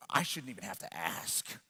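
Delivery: low, soft imitated grumble; sulky child. Boy told to ask his sister, quiet mock sulk. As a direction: grumble it under the voice. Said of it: "with this look on his face".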